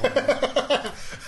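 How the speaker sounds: real laugh, high and falling; high burst of laughter. Real, not acted. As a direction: let the laugh ring out. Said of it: "I started laughing".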